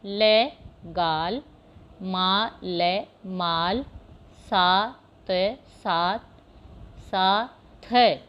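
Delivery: each syllable said apart, then joined into the word. Shown as a demonstration. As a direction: break the word into syllables, then blend them. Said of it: "मैं पढ़ देती हूँ". (अक्षर-अक्षर अलग करके फिर जोड़कर पढ़ना)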